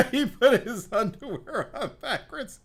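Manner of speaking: high, loud, laughing through it; cracking up while telling it. Real, not acted. Comic laughing about friend's backwards underwear.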